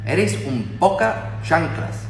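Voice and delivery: drawn out, slow Andalusian; slow Huelva accent. Shown as a demonstration. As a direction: stretch it slowly, Huelva style. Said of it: "en Huelva dicen".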